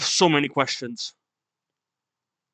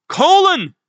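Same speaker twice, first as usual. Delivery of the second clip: desperate, high scream of a name; horrified shout; 13 semitones higher, 7 dB louder than usual. Acted. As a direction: cry the name out high and loud. Acting a horrified cry of name.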